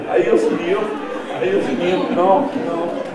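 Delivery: loud, high-pitched wailing mom in mock shock; hammed-up heart-attack lament, high and loud; Mexican Fred Sanford. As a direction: imitate a mother's melodramatic Spanish outcry. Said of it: "she starts doing the Mexican Fred Sanford".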